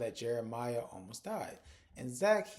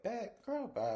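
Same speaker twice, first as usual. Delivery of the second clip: pitched up, brushing her off; dismissive scoff; 10 semitones higher, slower than usual. Retold or imitated. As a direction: wave the threat away, higher. Belinda scoffs at Lori's threat.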